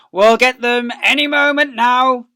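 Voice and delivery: loud, high, gloating; eager farmer shout. Acted. Boggis shouting to the others from his digger. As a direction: yell it over the engines.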